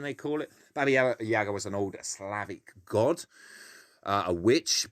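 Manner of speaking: usual speaking voice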